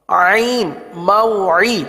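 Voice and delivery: عین and موعی with a squeezed throat ain; موعی with a full ain from the throat. Shown as a demonstration. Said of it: "عین کی آواز نکالنی ہے".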